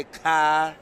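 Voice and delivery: dropped R Boston "ka"; Boston accent. Shown as a demonstration. Showing the Boston way to the co-host, Boston "ka". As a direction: say "ka" with no R, broad Boston. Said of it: "so we say".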